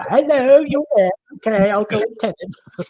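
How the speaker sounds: high, loud put-on voice of someone popping in; high-pitched mimicked hello, easing down at the end; imitated visitor greeting. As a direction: mimic a chirpy caller, pitched well up. Acted quote of Rocky arriving.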